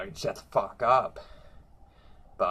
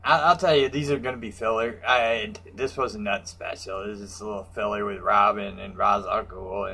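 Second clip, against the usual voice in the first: ordinary talk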